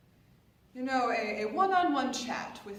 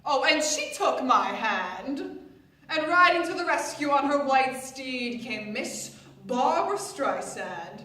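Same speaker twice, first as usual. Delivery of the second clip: loud, grand storytelling climax; triumphant exclamation; 3 semitones higher, 8 dB louder than usual. Acted. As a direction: proclaim it like a heroine's arrival.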